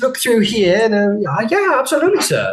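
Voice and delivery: high, polite clerk voice; clerk's voice. Acted. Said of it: "and this person goes".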